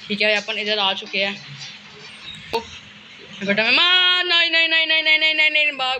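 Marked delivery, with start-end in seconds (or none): 3.5-6.0 high frantic run of nos; chased and panicking; panicked. Real, not acted. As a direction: beg in real terror. Chased, screaming no.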